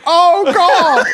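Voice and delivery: loud, high laughing impression; mock-ecstatic exclamation. Mimics man looking at trains.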